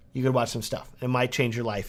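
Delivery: ordinary talk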